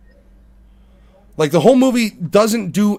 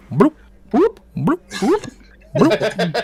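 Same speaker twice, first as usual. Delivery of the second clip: comic "bloop" mouth noises; mock sound effect blooped by mouth; imitated dripping sound; 2 semitones higher, much slower than usual. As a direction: imitate water plinking, note by note. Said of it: "It's just [name] in a microphone, just like".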